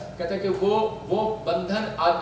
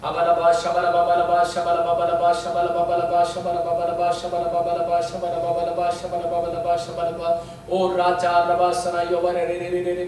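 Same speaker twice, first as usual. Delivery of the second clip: rapid monotone syllable chant; praying in tongues; 4 dB louder than usual. Real, not acted. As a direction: chant nonsense syllables in an unbroken stream. Chants in tongues during prayer. (अन्य भाषा में लगातार जाप जैसी प्रार्थना)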